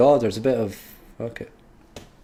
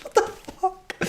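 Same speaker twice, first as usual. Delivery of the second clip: ordinary talk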